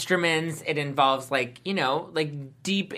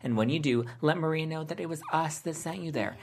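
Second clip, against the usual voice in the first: ad read; 5 semitones lower, 3 dB quieter than usual. Acted. Hosts read a podcast ad.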